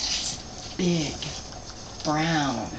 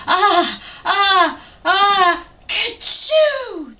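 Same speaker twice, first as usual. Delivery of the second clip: building ah-ah-ah into a loud, high ka-choo; a huge comic sneeze, rising and bursting; big sneeze; 11 semitones higher, 7 dB louder, much slower than usual. Acted. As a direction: ham up the bear's enormous sneeze. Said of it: "gave an enormous sneeze".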